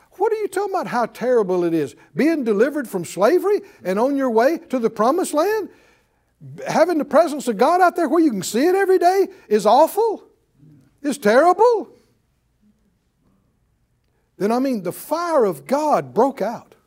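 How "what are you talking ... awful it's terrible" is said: high, emphatic disbelief; incredulous rebuke. Real, not acted. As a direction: throw their complaint back at them in disbelief. Mocking disbelief at the gripers.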